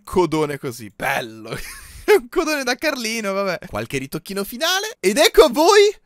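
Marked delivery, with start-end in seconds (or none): 5.2-5.9 high, loud triumphant cries; excited shouting. Real, not acted. Showing viewers the finished goat, yelling in triumph. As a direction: yell the big reveal at the top of your voice.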